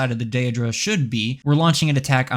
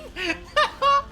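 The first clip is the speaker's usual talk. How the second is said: giddy laugh up high; 3 dB quieter than usual. Real, not acted. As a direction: burst into a high giggle. After grand proclamation.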